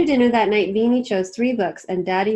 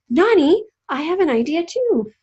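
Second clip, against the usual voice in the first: excited; 7 semitones higher, 4 dB louder than usual. Acted. Excited child interrupting grandma.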